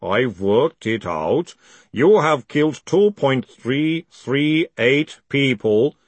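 very slow, weighty, measured accusation; calm golem. A narrator's reading. Golem tells Moist how many he killed, calmly. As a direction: count it out calmly and ponderously. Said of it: "said the golem calmly".

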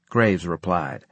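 ordinary delivery

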